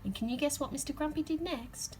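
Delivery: ordinary delivery, nothing marked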